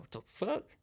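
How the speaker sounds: high-pitched, incredulous mock outrage; high, scornful retort in a put-on voice; indignant coworker. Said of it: "And she's like".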